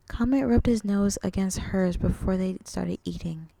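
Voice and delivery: plain reading voice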